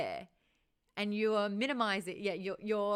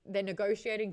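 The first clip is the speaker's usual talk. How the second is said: ordinary talk, nothing marked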